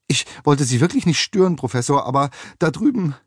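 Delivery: high, cracking with agitation; flustered cleaning woman whose voice cracks. A narrator's reading. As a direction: voice breaking upward in alarm. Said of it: "ihre Stimme überschlug sich".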